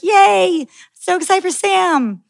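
high-pitched, drawn-out mock cheer; imitated fan excitement. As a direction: squeal it like a crowd of fans. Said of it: "everybody was like".

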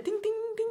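high tinkling ting sounds; bell imitation. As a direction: mimic a little bell.

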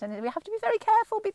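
a quick, lightly put-on Irish accent, pitched high. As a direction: slip into a playful Irish lilt mid-sentence. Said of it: "I start talking in an Irish accent".

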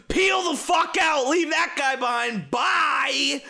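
high, stretched-out cry; mock getaway shout. Fleeing the attacking dinosaur, gleeful high shout. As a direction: call it out high as you speed off.

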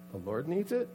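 soft, rising, unsure question; sheepish and questioning; tentative excuse. Retold or imitated. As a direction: try the excuse timidly as a question. Caught disciple tries the excuse.

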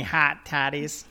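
lightly imitated Northern Illinois vowels on hot toddies; mock Northern Illinois accent. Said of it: "my Northern Illinois is showing".

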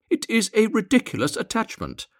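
very high, loud bird chatter; twittering swallows. A narrator's reading. Other swallows scorning his love for the reed, high twittering. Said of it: "twittered the other swallows".

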